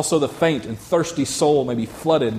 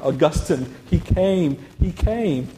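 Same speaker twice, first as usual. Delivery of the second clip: joyful outburst; 5 semitones higher, slower than usual. A narrator's reading. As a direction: read it as a restrained burst of joy. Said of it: "with such great joy".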